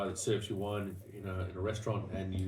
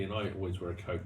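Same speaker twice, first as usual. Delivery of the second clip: ordinary delivery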